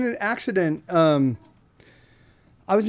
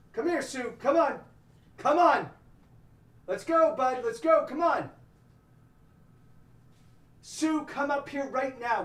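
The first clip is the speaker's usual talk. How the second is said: raised voice calling from a distance; yelling across the room; 5 semitones higher, 3 dB quieter than usual. Real, not acted. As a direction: call the dog over from far off. Calling the dog to come up.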